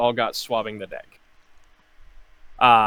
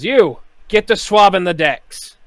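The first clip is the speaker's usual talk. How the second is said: loud, high barked command; bosun shouting orders; 8 semitones higher, 13 dB louder than usual. Acted. Bosun ordering Awen to swab the decks, loud shout. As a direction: snap the order at the deckhand. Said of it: "he says".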